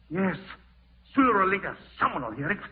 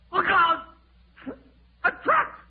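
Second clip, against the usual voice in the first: high, loud panicked screams; terrified screaming; 8 dB louder than usual. Acted. Man sealed in the vault screams in terror, high and loud.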